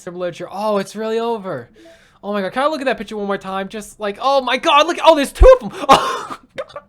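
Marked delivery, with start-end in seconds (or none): none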